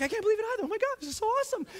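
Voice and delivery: high, rushed gushing; excited. Retold or imitated. Teacher answering congratulations after her speech, high excited gush. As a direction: squeal it out in delighted disbelief. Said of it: "I'm so excited about it".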